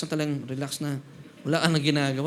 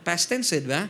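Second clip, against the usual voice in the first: usual speaking voice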